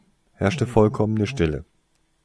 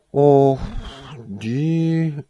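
feigned innocence; 6 semitones higher, 6 dB louder than usual. Acted. Toriesa plays innocent about the punishment. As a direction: put on an innocent air. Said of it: "tat Toriesa unschuldig".

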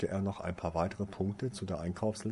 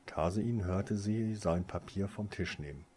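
ordinary delivery

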